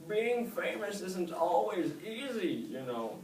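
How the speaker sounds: high, slow, loud wise snail; old snail questioning young Oscar. A narrator's reading. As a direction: ask slowly like an old sage.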